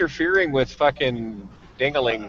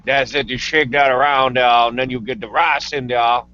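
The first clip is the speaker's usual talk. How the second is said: lightly put-on Cajun accent, describing cooking; a touch of Louisiana Cajun in the voice; 3 dB louder than usual. Hammed-up Cajun accent telling a fellow Cajun how to cook crawfish. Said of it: "that's not my Louisianan accent. My Louisianan accent is".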